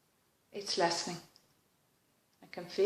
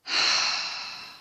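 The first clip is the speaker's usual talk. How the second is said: a long sigh letting tension go; full unvoiced sigh; releasing sigh. Real, not acted. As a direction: breathe out the frustration. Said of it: "I'm noticing I'm less frustrated".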